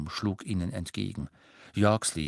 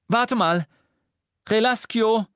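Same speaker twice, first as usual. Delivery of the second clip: high, loud call and spell; shouted spell; 13 semitones higher, 7 dB louder than usual. A narrator's reading. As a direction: call out, then cast. Hermione casts a spell.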